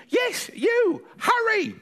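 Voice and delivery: high, bossy granny hurrying him along; urgent granny. Acted. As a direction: push the boy to the door.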